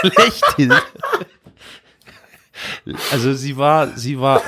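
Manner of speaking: high giggly laugh through the name; stifled giggles. Real, not acted. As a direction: burst out laughing mid-word. Laughing fit over the name.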